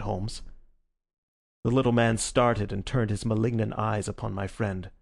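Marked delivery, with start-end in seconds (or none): none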